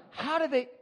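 astonished at the VR ocean; amazed wonder. Retold or imitated. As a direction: gape at the ocean video. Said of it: "I'm like".

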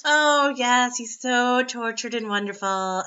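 dreamy fangirl sigh, hammed-up; mock swooning. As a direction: gush like a lovesick reader.

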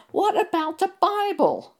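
high, loud outraged cry; screamed out. Retold or imitated. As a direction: scream it at the TV in protest. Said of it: "And I screamed out".

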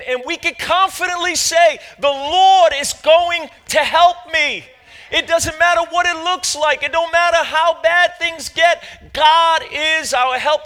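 loud, high, shouting sermon; rousing shout from the pulpit; full force preaching. Real, not acted. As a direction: preach it to the back row.

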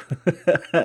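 high, loud burst of laughter; amused laugh. Acted. Refusing partner's request, loud amused laugh. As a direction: laugh loudly at the idea.

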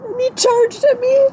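real tearful, very high-pitched voice; crying. Real, not acted. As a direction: struggle to get the story out through sobs. Crying as she recounts the attack.

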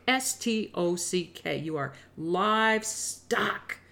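S T O C K spelled slowly, then "livestock" stretched out; spelled out with scorn. Real, not acted. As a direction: let "livestock" sink in, very slow.

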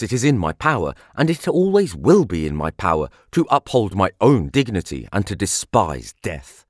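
ordinary delivery, nothing marked

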